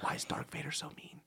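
put-on girlfriend's whispered movie question; hushed mimicry in the cinema; imitated whisper. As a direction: whisper it, innocently puzzled. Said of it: "She goes".

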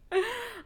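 short high-pitched laugh. Real, not acted. Laughing at her own blunt turn-down of a suitor, high laugh. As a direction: burst into a quick high laugh.

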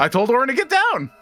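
high-pitched, insistent protest; loud protest. Acted. Player protests ally will be hit.

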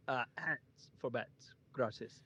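lightly imitated Cuban accent, slow; a hint of a Cuban accent, drawn out slowly; slow mock Cuban accent. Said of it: "the accent".

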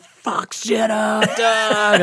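high rowdy yell; shout. Retold or imitated. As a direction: yell it at the stage like a punk fan. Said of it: "I'm like".

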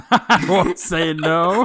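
high, slow, laughing echo. Retold or imitated. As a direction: repeat it high through laughter. Repeating friend's line laughing.

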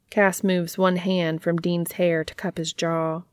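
plain reading voice

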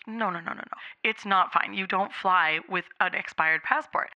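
hushed official no. Retold or imitated. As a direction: quietly shut her down. Guy firmly refuses.

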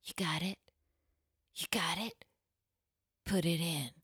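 soft, breathy pop-star whisper. Retold or imitated. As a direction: breathe it close to the mic. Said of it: "[name] going".

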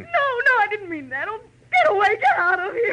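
high, loud panicked pleading. Acted. Louise panics at the body and orders Marlowe out, frantic. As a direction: push him away in rising panic.

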